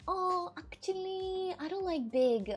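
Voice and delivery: drawn-out, high mock whine; Paulina. Streamer mimics friend rejecting the prototype, high mock whine. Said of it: "She's like".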